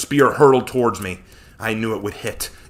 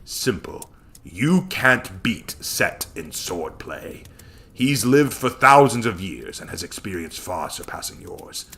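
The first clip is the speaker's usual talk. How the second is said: lower, soft, measured teacher's voice; calm wise mentor; 5 semitones lower, 6 dB quieter, slower than usual. Acted. Hachiman's remembered lesson to his student, calm and low. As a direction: recall a patient master's lesson, unhurried. Mentor answers her question.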